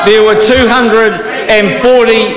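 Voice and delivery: loud heated debating shout; shouting angrily. Real, not acted. Heated reply in parliament.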